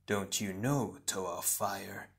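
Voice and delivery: soft, slow sinister shadow double; quiet, drawn-out menace; hissed. A narrator's reading. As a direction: taunt in a hushed hiss. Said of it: "hissed the shadow Tahu".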